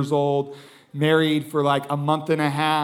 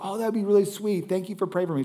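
higher, soft voice of a polite lady; soft, higher polite thanks; retold polite lady; 4 semitones higher, 6 dB quieter, faster than usual. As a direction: lightly voice a sweet, grateful stranger. Lady politely brushes off offer.

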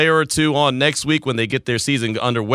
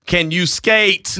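slow emphatic; 4 semitones higher, much slower than usual. Real, not acted. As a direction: stretch each word out, demanding. Repeats question, drawn out.

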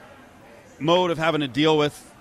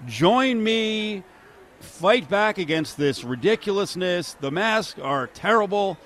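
mock rallying call; 6 semitones higher, slower than usual. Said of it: "calling to the crowd".

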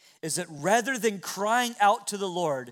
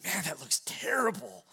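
inner whisper; 5 dB quieter than usual. Retold or imitated. Father's private thought about son.

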